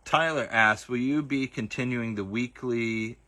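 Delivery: usual speaking voice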